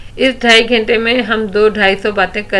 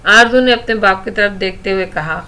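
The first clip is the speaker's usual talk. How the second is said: usual speaking voice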